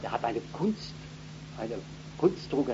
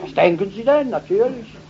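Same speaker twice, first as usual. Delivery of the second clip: high, loud, offended reply; indignant surprise; 10 semitones higher, 8 dB louder than usual. Retold or imitated. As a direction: sound astonished anyone would ask. Voicing the woman's retort. (erstaunt und empört, hoch gesprochen)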